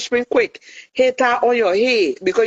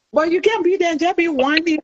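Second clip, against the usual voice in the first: ordinary talk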